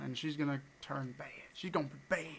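ominous whisper. Acted. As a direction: whisper it like a dark secret. Ominous warning about friend.

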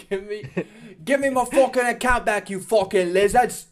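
high, loud imitation of a ranting fighter; mock fighter shout. Co-host re-enacts fighter's rant at Instagram, mock shouting. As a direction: yell the demand in hammed-up outrage.

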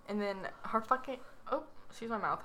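normal talking voice

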